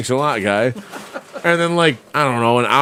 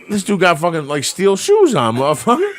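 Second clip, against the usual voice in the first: ordinary delivery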